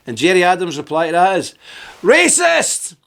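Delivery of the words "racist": shrieked accusation, pitch way up; mock shout. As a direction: fling the insults like a heckler across the road.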